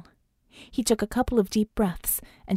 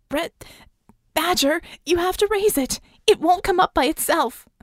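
high, loud yell of instruction; high-pitched frantic urging; urgent shout; 9 semitones higher, 4 dB louder than usual. Acted. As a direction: shout to rouse a faltering partner. Vicky shouting urgent instructions to Red.

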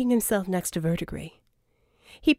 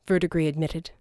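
plain reading voice

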